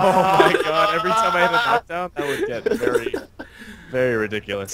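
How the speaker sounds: high-pitched, laughing through the words; real laughter. Real, not acted. Player reacting to co-host's anime yell joke, laughing. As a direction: struggle to talk while cracking up.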